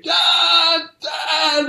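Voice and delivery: high, loud cry of Dad, reliving the scare; boy in bed yelling for his father at angels, high shout; child screaming for dad. Retold or imitated. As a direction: call out for dad like a terrified little boy. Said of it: "I would start screaming".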